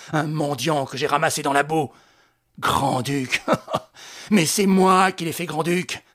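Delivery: loud, contemptuous rant; a master berating his puppet; scornful Lupin. A narrator's reading. (Lupin méprisant, ton rageur)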